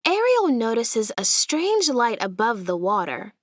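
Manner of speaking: plain reading voice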